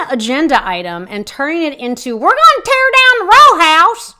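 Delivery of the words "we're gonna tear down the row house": much higher and louder, hammed-up panic; mock alarmist shout. Podcaster mocking the council's scare narrative with a hammed-up shout.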